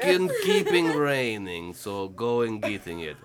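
slow Russian mother voice; fake Russian accent. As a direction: plod through broken English as a Russian mom. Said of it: "With or without the accent?".

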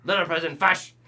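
a hammed-up Scottish cry on "fash"; Scottish accent. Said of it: "So he said it all Scottish".

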